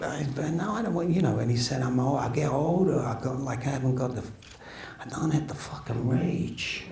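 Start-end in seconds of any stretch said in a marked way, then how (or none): 1.8-6.8 Joe Pesci impression. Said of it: "Do you wanna hear Joe Pesci?".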